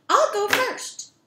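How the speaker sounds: high little goat voice, eager and loud at first; high-pitched little kid goat; littlest billy goat. Acted. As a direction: pipe up as the smallest goat. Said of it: "So the littlest billy goat said".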